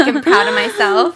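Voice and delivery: loud, high and proud with a laugh; all excited. Retold or imitated. Describing how she told her friend, proud and excited. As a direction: beam with pride, slow and loud. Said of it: "all excited".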